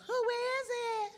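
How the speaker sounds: very high, slow falsetto lady voice. Retold or imitated. Lady at the door answering the knock in a very high, slow falsetto. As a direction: squeal it like a high-pitched lady.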